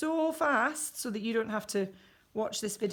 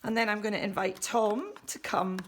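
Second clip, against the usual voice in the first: usual speaking voice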